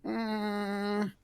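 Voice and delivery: hesitant creature hum; uneasy kobold. Acted. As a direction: stall with a long uncertain "mm". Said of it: "shifts around uneasily there".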